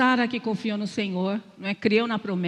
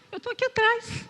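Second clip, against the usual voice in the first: much higher, a light touch of the Spirit speaking; gentle divine voice; 13 semitones higher than usual. Retold or imitated. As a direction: lift the voice high as the Spirit's reminder. Voicing the Holy Spirit's rebuke. (voz do Espírito Santo, bem mais aguda, leve)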